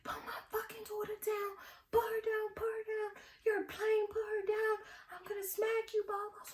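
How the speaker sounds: high, fierce mom threat; threatening mom. Retold or imitated. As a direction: mom ordering a lady to drop her daughter.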